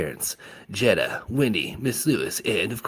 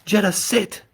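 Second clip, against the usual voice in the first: sharp high order to Jetta; high loud command; 13 semitones higher, 7 dB louder than usual. A narrator's reading. Woman commanding at party.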